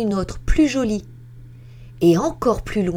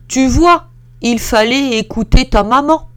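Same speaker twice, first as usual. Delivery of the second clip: loud, higher calling out; big voice shout; 7 semitones higher, 8 dB louder than usual. Acted. Big bear calls after the fleeing girl, loud shout. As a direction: call after her loudly. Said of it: "lui crie de sa grosse voix".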